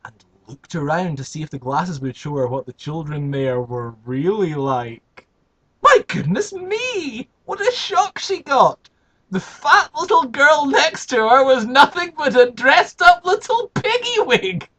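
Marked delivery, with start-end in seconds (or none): 5.8-14.7 high, loud astonished telling; shocked narration. A narrator's reading. Narrator revealing the piggy girl, high and astonished. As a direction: gasp it out in shock.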